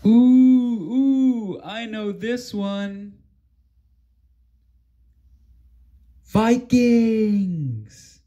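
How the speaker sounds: high, bursting to answer; like a kid with the answer; eager guess. Acted. An eager friend guessing who did it, bursting excited.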